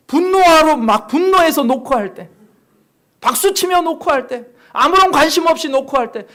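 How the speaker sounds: 높고 큰 목소리로 몰아치는 설교; 구절마다 힘을 실어 외치듯 설교. Real, not acted. (목청 높여 힘차게 설교)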